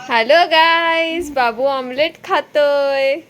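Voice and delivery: slow, high, loud drawn-out hello like her son; mock vlogger greeting. Mother copying her son's vlog greeting in a slow high voice. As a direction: stretch it out like a kid playing vlogger.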